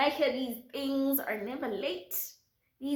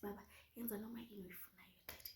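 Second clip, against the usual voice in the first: urgent soft whisper; hushed plea; 20 dB quieter, much faster than usual. Retold or imitated. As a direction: breathe it to the driver.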